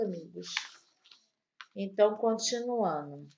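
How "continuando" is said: ordinary delivery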